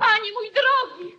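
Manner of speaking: high, loud call; queen crying out as her husband falls; alarmed cry. Acted. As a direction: reach out in alarm. Husband collapses.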